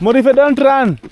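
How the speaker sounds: loud, high call to a running dog. Real, not acted. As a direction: shout after the dog to stop it.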